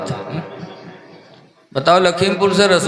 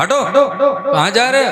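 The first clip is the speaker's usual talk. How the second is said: high, sharp shoo-away; scolding angel; 6 semitones higher than usual. Acted. Angel shoving Harun from the door, high scolding shout. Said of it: "झिड़क दिया".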